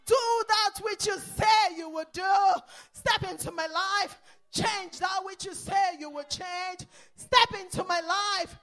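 loud, high, ringing sermon exhortation; full-force preaching. Acted. Preacher urging the church to pray, loud preaching. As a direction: drive it home with every line.

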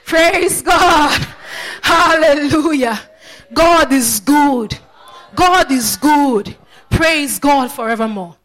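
loud, drawn-out shouts of praise; preaching praise. Real, not acted. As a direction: proclaim it to the congregation, loud and slow. Preacher leads praise at full force.

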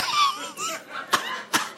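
high, breathy burst of laughter. Real, not acted. Laughing at a pastor's joke about never tiring, high laugh. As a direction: crack up at the joke.

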